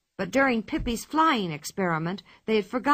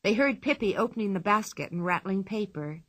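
ordinary delivery

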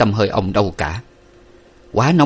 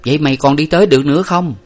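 quick, higher-pitched asking; urgent and quickened; hurried question; 6 semitones higher, 3 dB louder, faster than usual. A narrator's reading. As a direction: ask your sister in a hurry. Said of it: "Nguyệt vội hỏi".

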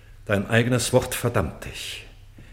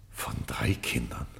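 Flüstern; 12 semitones lower, 9 dB quieter than usual. Acted. König staunt über seine Kinder.

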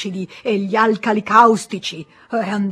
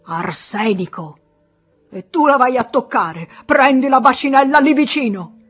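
loud, high, outraged scolding; angry lecture; 3 semitones higher, 5 dB louder than usual. Acted. Pharmacist lectures the terrified boy, loud outraged scolding. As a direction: hammer every danger home.

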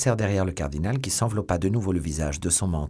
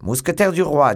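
loud reply; 7 semitones higher, 6 dB louder than usual. A narrator's reading. Musketeer answers loudly.

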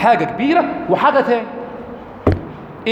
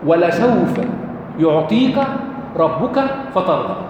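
Quran recitation; 3 semitones lower, slower than usual. Acted. Quran verse recited in lesson.

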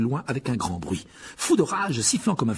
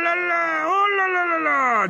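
very high, loud theatrical wail; Lockhart's fluttery cry; 6 dB louder, slower than usual. A narrator's reading. Lockhart watches the duel results, crying out very high. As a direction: fuss over the duel results, shrill and high. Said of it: "dit Lockhart en observant".

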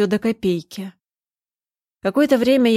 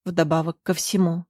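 ordinary delivery, nothing marked

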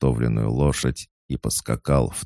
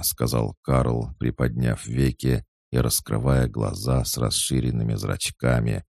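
plain reading voice

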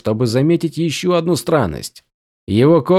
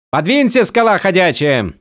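brash woman's voice; 6 semitones higher than usual. A narrator's reading. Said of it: "раздался голос за спиной".

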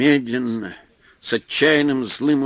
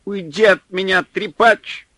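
higher, loud shrill shriek; 8 semitones higher, 5 dB louder than usual. A narrator's reading. Supply man shrieking at Pavel to leave him. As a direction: squeal it out in desperation. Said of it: "взвизгнул снабженец".

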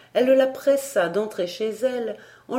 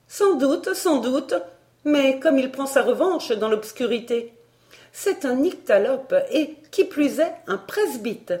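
high, louder, indignant concession; vehement denunciation; 8 semitones higher, 4 dB louder than usual. Acted. Barbara denouncing the tutor to Elsie, vehement. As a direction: brush it aside and accuse him, pitched up.

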